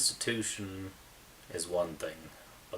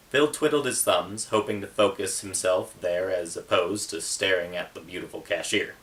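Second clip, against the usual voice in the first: steady, louder reading voice, building toward the fire scene; story narration; 3 semitones higher, 8 dB louder than usual. A narrator's reading. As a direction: read it like an audiobook that grows tense. Author reads his novel aloud.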